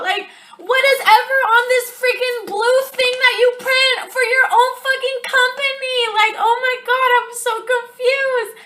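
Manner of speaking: high, loud, fast, mocking yell; mocking shrill boss rant. Mocking the CEO yelling at her down the phone, loud and high. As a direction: blast it like the furious boss on the phone.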